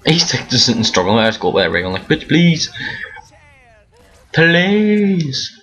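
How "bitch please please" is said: high, drawn-out sassy "please"; sassy brush-off; mock sassy taunt. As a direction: taunt the teammate with attitude. Trolling teammate with ray gun.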